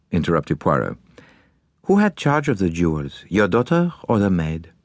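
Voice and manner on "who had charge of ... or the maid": put-on courteous, accented detective voice; formal Belgian-accented questioning. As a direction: accept the case as a polite foreign sleuth.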